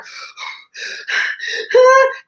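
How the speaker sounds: unvoiced breath out; heavy nervous breath. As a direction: gather your nerve with a breath. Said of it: "breathing really heavily".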